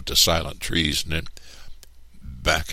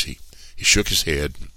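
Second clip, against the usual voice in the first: ordinary delivery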